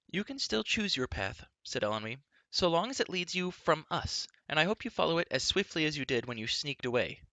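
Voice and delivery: put-on spirited girl sending a rival packing; princess's voice. A narrator's reading. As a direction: pile on the mockery. Elenwy's voice.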